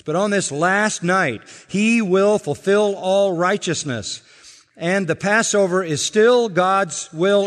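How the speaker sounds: loud impassioned pulpit delivery; preaching at full force. Acted. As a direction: preach it hard, rising to the climax. Preaching climax on the last night.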